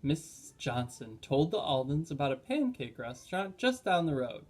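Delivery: ordinary delivery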